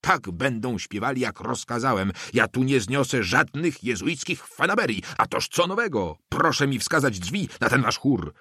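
high, strained outburst without extra volume; mild acted shout. A narrator's reading. Russian inspector overruling the priest, contained angry shout. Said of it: "krzyknął Rosjanin".

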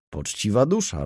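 ordinary delivery

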